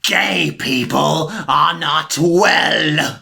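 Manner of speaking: very slow, heavy declaration; slow mock pronouncement. Aunt declaring her bigotry, slow and pompous. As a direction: drag out the bigoted verdict.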